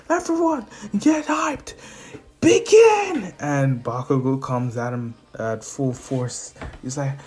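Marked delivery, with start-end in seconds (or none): 0.1-3.3 high strained arena announcer; mock announcer cry, building to a loud start; hype announcer. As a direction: whip up the crowd and launch the match.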